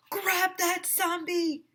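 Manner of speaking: a high, slow call to grab it; shout. Acted. AJ yelling at the class to grab the zombie. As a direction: yell the order across the gym. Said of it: "I shouted".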